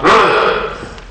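high-pitched growly "ugh" of frustration; exasperated, hammed-up grunt; mock enraged grunt. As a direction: act out bursting with pent-up anger. Acting out wanting to kill everything.

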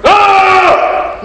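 high strained shouts of lifting; imitated straining yells. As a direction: yell like you're lifting to failure. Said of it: "That's all you heard".

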